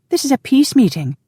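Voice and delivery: scandalised female voice; indignant. A narrator's reading. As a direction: object as the affronted heroine. Wife indignantly defends the meeting.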